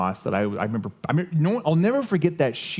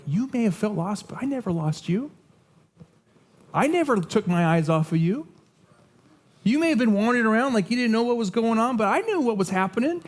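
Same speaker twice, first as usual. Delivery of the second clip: God reassuring; 8 semitones higher than usual. Acted. God voiced as reassuring father.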